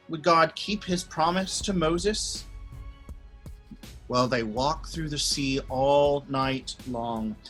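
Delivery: ordinary delivery, nothing marked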